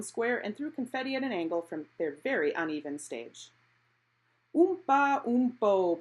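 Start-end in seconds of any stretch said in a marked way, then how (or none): 4.6-6.0 loud, slow brassy oompa; blaring off-key band. Acted. Narrator imitates the off-key town band, loud and slow. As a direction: mimic a tuba blaring out of tune. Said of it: "blared the off-key band".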